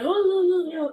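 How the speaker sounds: mock denial. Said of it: "He's like".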